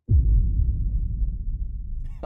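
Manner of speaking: airy unvoiced burst of laughter; breathy laugh. Real, not acted. Laughing at the chatbot answer to his audience, breathy.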